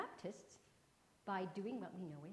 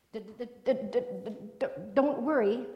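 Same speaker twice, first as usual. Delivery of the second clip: louder, stuck on the d before breaking through; stuttering; 19 dB louder than usual. Acted. Sam comforting Maggie through a heavy louder stutter. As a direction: stutter hard on the first sound, then push the words out. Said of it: "stuttered Sam".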